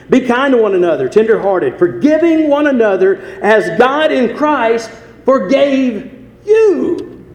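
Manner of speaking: loud, high scripture proclamation; full-force preaching. Acted. Preacher declaring a verse to the congregation, full-force preaching. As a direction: ring it out to the congregation, stretching the final words.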